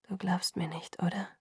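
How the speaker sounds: very soft, near-voiceless question; whisper. A narrator's reading. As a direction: whisper it, hurt and hushed. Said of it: "flüsterte er". (leise geflüstert)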